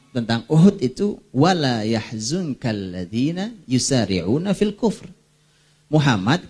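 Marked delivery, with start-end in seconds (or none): 1.3-5.1 slow chanted Arabic recitation; Quran recitation. Shown as a demonstration. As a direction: recite the verse slowly, Quranic style. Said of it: "Al-Quran mengatakan". (bacaan ayat Quran yang lambat)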